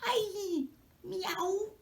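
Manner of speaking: high, yelping cat cry; high startled meow. A narrator's reading. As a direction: yowl as if stepped on. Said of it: "il fait".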